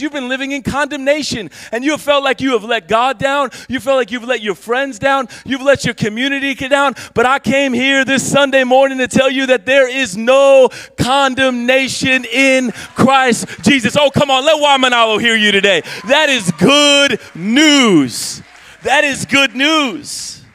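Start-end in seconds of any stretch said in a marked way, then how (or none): 1.7-15.8 loud, rising pulpit fervour; full preaching. Acted. Pastor rousing the Sunday congregation, loud fervent preaching. 16.1-18.4 slow, loud, drawn-out shout; shouted proclamation. Acted. Pastor proclaiming to the congregation, slow loud shout.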